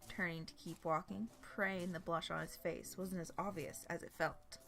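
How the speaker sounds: ordinary delivery, nothing marked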